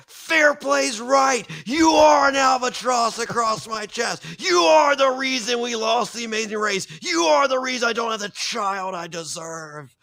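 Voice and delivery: high-pitched, full-voiced retold shout; screaming it back. Husband turning on his wife mid-challenge, imitated scream. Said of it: "and screams it back. He goes".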